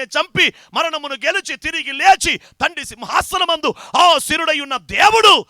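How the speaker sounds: loud shouted proclamation building to a peak; preaching at full force. Acted. Preacher proclaims to the church in a loud shout. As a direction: thunder it out to the congregation. Preacher's climax on God's throne.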